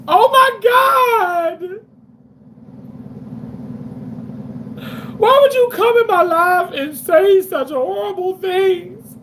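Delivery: overdone fake cry in a mock tantrum. Reading a viewer comment aloud as a hammed-up wail.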